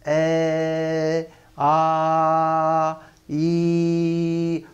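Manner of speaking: ए, आ and ई each held long and level, about three times a short vowel; pluta vowels. Shown as a demonstration. Teacher demonstrating the three-beat pluta ए, आ, ई, held long and level. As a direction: stretch ए, आ, ई out like a call across a field. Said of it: "ह्रस्व का तीन गुना समय जब उच्चारण में लगे".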